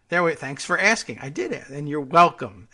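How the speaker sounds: normal talking voice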